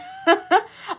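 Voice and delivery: squealing laugh; high burst of laughter. Real, not acted. Laughing at the chat's tour question, high burst. Laughs after her outburst.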